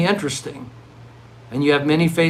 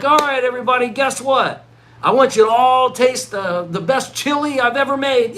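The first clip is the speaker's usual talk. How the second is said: higher and louder, hammed-up enthusiasm; mock excited host; 9 semitones higher, 3 dB louder than usual. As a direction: announce the chili like a big prize. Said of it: "and I get up, and I'm like".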